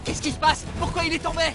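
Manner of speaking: alarmed. Acted. Chief sees his man fall, alarmed. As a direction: ask in sudden alarm.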